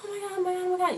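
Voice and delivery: mock breathless fangirl squeal; excited little girl. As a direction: imitate a thrilled kid who can't get words out. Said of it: "she was so excited".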